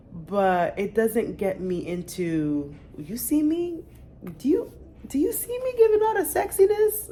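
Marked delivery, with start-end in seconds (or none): 3.0-7.1 high, loud, hammed-up sultry tease; mock seductive voice. A mom teasing her viewers with a mock sexy voice. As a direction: ham up a sexy pose voice.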